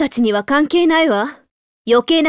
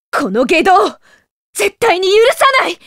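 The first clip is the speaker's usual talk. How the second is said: angry vow; 5 semitones higher than usual. Acted. Furious at the villain.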